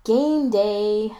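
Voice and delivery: upbeat game-day announcement; bright. A narrator's reading. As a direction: greet the day with a bright grin. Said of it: "Spencer says brightly".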